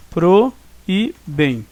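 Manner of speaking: "pro... e... bem", slowly, a pause after each; split into syllables. Shown as a demonstration. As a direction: separate PRO, E and BEM clearly. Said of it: "porque se separa assim".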